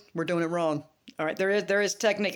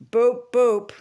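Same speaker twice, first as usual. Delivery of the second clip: playful boops; 4 semitones higher, 8 dB louder than usual. Acted. Playful shout-out noise.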